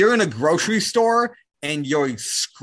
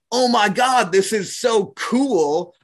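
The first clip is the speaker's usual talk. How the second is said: mock gushing excitement; 7 semitones higher than usual. A man mocking the excited reaction he did not have. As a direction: play the wide-eyed tourist who is thrilled by nature.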